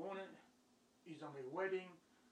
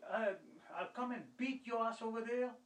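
higher-pitched, indignant cursing; heated re-enacted threat; retold angry threat; 7 semitones higher than usual. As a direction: imitate an irate caller making threats. Acts out the caller's threat.

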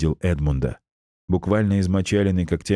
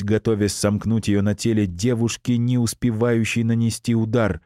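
usual speaking voice

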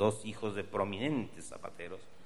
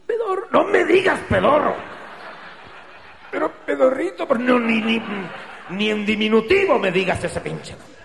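much higher, louder falsetto plea; shrill pleading; 11 semitones higher, 10 dB louder than usual. Acted. Enedina squealing at the attacker not to call him that name, high. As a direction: whine at him not to use the nickname. Girl begs about nickname.

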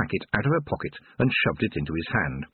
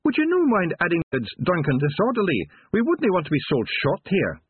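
high Scottish Feegle, cheekily polite; high-voiced rogue proud of his crimes; Rob Anybody's cheeky voice; 10 semitones higher than usual. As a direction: make a cheeky request in high-pitched Scots.

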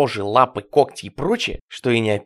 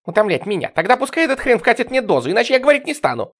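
high, loud and speeding up; defiant outburst; 10 semitones higher, 6 dB louder than usual. A narrator's reading. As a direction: flare up shrilly and lay down terms. Patient's defiant outburst at officer. (возмущённый крик на высоких тонах)

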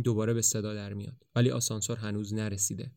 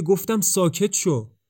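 louder, higher, slow angry order; 7 semitones higher, 6 dB louder, slower than usual. Acted. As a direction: cut him off sharply, fed up.

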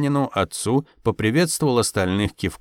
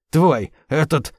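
spluttering rage; 4 semitones higher, slower than usual. A narrator's reading. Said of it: "забрызгал сосед слюною".

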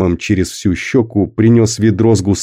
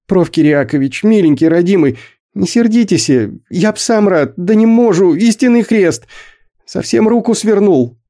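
put-on much higher, weak boy's voice begging; weak muffled pleading; 11 semitones higher than usual. A narrator's reading. Stuck boy begs the boss from inside the pipe, weak muffled plea. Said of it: "слабый, приглушённый металлом голос".

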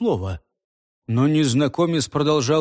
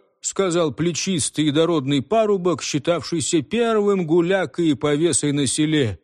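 plain reading voice

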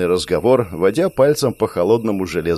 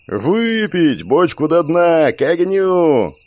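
put-on drunken crowd shout; 7 semitones higher, much slower than usual. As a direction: chant it with the rowdy crowd. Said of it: "Поднялись крики над толпой".

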